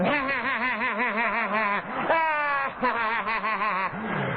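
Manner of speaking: high cackling fit of laughter, retold; imitating wild laughter. Sponsor dropping the phone, howling with laughter. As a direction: throw back your head and howl with laughter. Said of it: "I hear him going".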